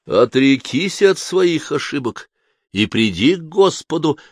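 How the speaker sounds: slow, stern priestly command; stern demand. Acted. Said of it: "потребовал отец Августин ещё более сурово".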